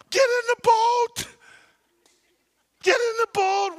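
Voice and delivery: shouted high and loud, begging; urgent plea. Retold or imitated. Disciples pleading with Jesus to get in, loud urgent call. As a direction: call him into the boat.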